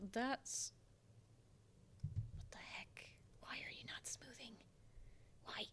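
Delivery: slow whispered annoyance; frustrated whisper. Real, not acted. Artist whispering at a smoothing tool that won't work. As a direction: hiss it at the stubborn tool.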